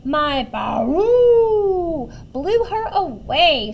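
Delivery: loud, slow trumpeting brag; shouting storybook elephant. A narrator's reading. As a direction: trumpet the baroo high and long, then boast. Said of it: "shouted the elephant".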